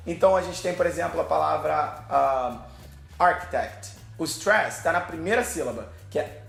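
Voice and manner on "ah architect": usual speaking voice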